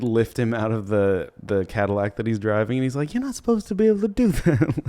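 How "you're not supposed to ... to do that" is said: very high, loud squeal of disbelief; shocked protest. Retold or imitated. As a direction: shriek in outrage at being lifted. Peace lifted from the car.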